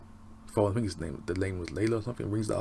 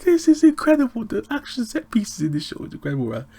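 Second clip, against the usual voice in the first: much higher and louder, thrilled; excited gushing; 13 semitones higher, 8 dB louder than usual. Real, not acted. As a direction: rave about it, carried away. Gushing about the show's action.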